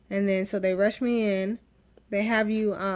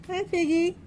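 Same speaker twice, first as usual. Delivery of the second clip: much higher, sing-song greeting; pet voice; 9 semitones higher than usual. Real, not acted. Mother greeting her cat Figgy in a high pet voice. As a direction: coo hello to the cat.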